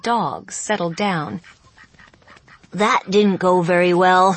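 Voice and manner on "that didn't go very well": put-on louder young goose voice; a gangly young goose, deflated. A narrator's reading. The goose speaks.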